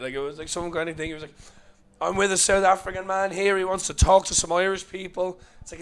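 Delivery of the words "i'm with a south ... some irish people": a higher, louder mock announcement; announcing friend. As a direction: herald the arrival, hammed-up. Imitating the friend announcing himself.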